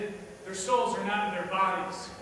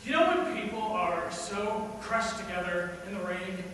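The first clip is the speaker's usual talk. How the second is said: usual speaking voice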